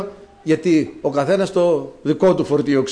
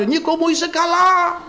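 calling out; 12 semitones higher than usual. Retold or imitated. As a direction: shout up to the child on the fourth floor. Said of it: "και εγώ να φωνάζω".

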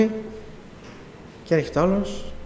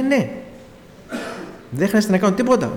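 confident insistence; 7 semitones higher than usual. Retold or imitated. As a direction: insist there's nothing to do but wait. Brother insists God will provide.

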